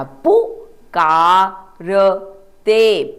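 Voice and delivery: पुकारते stretched out very slowly, each syllable drawn apart. Shown as a demonstration. Teacher to class, slow sounding out of the word. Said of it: "प को ऊ की मात्रा".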